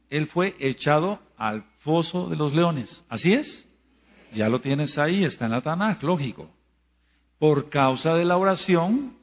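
loud, rising preacher refrain hammered home; preaching at full force. Acted. Preacher to congregation on the power of prayer, loud pulpit refrain. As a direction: drive each line up like a pulpit cry.

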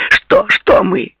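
loud, high, drawn-out demand; angry question. A narrator's reading. Angry girl cuts off Paul's excuse, demanding and loud. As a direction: cut in angrily, high and slow. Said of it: "сердито спросила она".

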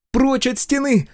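high, loud urgent yell; shouting. A narrator's reading. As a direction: shout a warning. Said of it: "выкрикнула Сол".

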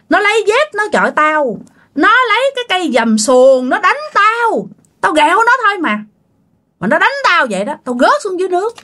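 high-pitched aggrieved retelling of an attack; acted indignant accusation. Spirit complaining it was hit for teasing, indignant. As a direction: protest as the wronged spirit.

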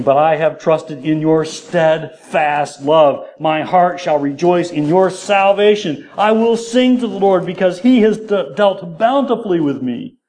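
drawn-out declamation climbing in pitch; exultant scripture reading. Acted. Preacher reads David's trust in salvation, exultant.

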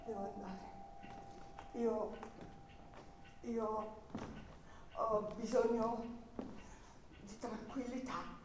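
soft, weary dismissal; congedo stanco. Acted. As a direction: let her pull away gently, tired. Lo congeda con calma triste. (miss Buseman sending K away, sad and soft)

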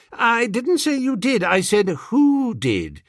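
high, slowed correcting gentleman; exasperated toff. Acted. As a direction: correct her like a peeved aristocrat. Bertie corrects maid.

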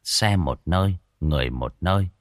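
lively village ghost-story telling; storyteller voice for a spooky folk tale; story narration. A narrator's reading. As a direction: draw the listener into the scene. Story narration after the intro.

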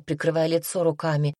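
usual speaking voice

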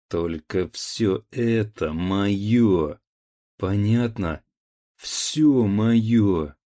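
slow, loud possessive yelling; drunken angry shout. A narrator's reading. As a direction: bellow it, drunk and grasping. Drunk son claims inheritance.

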